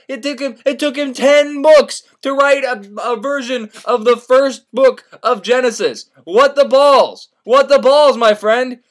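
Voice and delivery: high, loud, emphatic disbelief; incredulous rant. Retold or imitated. Senator rants about a long book, loudly incredulous. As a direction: punch it out loudly in sheer disbelief.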